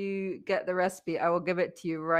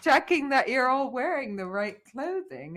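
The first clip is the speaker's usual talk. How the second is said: words bubbling with laughter; laughing while talking; 6 semitones higher than usual. Real, not acted. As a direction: giggle through the story. Laughing while retelling gym story.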